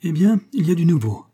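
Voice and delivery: high, eager gossip's announcement; excited exclamation. A narrator's reading. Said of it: "s'exclama avec des yeux allumés". (exclamation excitée, voix haut perchée)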